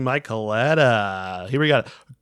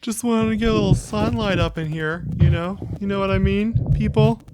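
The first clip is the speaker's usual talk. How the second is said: higher teasing parroting voice; mocking imitation; 6 semitones higher than usual. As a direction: parrot her words back, mocking. Teasingly parrots co-host's excuse.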